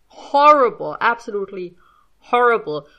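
"Horrible" pushed loud, then "horrible" punched again; emphatic stress on horrible. Real, not acted. Speaker condemning own cartoonish French accent, "horrible" stressed emphatically. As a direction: land hard on each "horrible", with "Horrible" highest and loudest.

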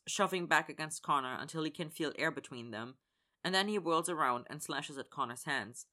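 ordinary delivery, nothing marked

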